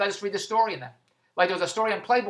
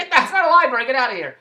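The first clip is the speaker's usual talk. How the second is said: louder, higher mock store clerk chasing him off; shooing clerk; 4 semitones higher, 6 dB louder, faster than usual. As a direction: bark like a clerk throwing out a freeloader.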